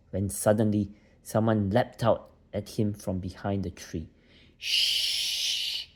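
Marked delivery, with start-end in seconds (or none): 4.6-5.9 a sharp, breathy hiss to silence him; hissing shush. Acted. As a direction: hush the boy with a fierce hiss. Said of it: "said someone in a hissing voice".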